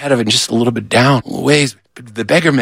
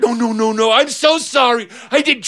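high, flustered pleading; panicked apology; 10 semitones higher than usual. Acted. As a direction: babble it up high, begging pardon. Beggar begs pardon.

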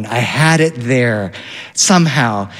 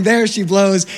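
mild mock yell; 6 semitones higher than usual. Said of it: "all the kids are yelling".

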